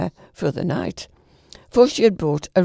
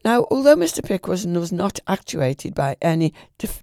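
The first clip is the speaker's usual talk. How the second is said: usual speaking voice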